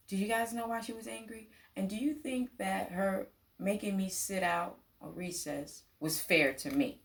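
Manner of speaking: ordinary delivery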